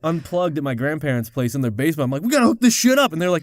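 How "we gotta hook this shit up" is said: put-on eager, louder kid; pushing to hook the phone up; a kid's mock excitement; excited kid. Excited kid voiced in story.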